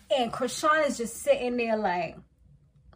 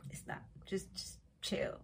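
whispered plea; 4 semitones lower, 17 dB quieter than usual. Retold or imitated. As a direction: whisper it to her like a warning. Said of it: "it's like, girl".

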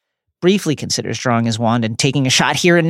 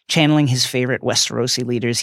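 ordinary talk, nothing marked

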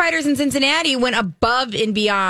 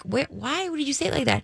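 baffled parents, hushed; 3 semitones higher, 7 dB quieter, faster than usual. Retold or imitated.

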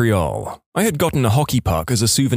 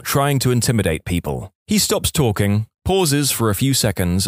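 usual speaking voice

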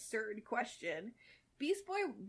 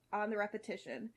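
normal talking voice

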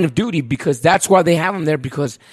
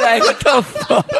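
ordinary talk

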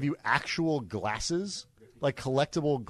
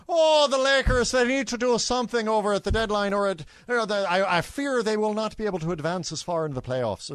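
put-on Irish accent; 7 semitones higher, 3 dB louder than usual. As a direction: lean into a cartoon leprechaun Irish accent. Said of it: "I would do an Irish accent".

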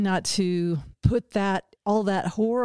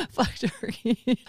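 laughing repeat; slower than usual. Retold or imitated. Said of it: "that's pretty funny though".

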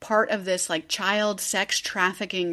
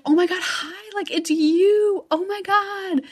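much higher, gushing reunion greeting; mock excited squeal; 11 semitones higher, 4 dB louder than usual. Sister greets her long-lost brother, mock overjoyed.

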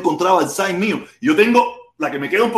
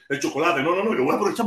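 usual speaking voice

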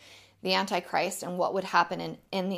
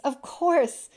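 higher-pitched exclamation; crying out; 6 semitones higher, 3 dB louder than usual. A narrator's reading. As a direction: exclaim in sudden realisation. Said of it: "cried the prince".

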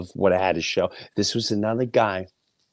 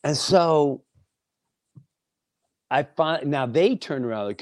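ordinary talk

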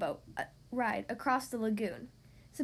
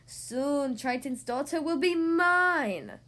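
slow, louder sea witch gloating; sinister declaration; 4 dB louder, much slower than usual. Acted. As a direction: drag out the threat like a villain. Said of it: "she declared".

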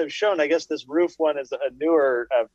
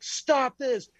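mock panicked screaming; 10 semitones higher than usual. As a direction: plead like you're strapped to the chair. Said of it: "screaming bloody murder".

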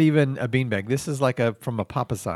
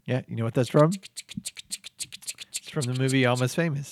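ordinary talk, nothing marked

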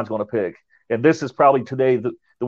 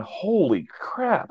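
higher, slow, hushed gasp of awe; stunned awe; 8 semitones higher, 5 dB quieter, much slower than usual. Retold or imitated. Host hearing the ghost voice, slow stunned hush. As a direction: let the shock sink in.

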